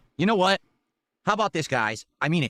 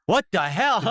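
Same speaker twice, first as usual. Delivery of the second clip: ordinary talk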